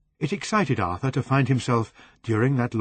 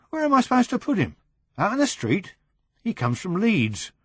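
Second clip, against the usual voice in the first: fast and higher, pleading; fast, higher exasperation; exasperated; 10 semitones higher than usual. Acted. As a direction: rattle off helpless objections. Brian exasperated, pushed to take Potter.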